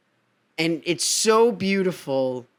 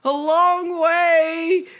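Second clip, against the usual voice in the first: lightly voiced Orpheus; 9 semitones higher, much slower than usual. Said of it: "He goes".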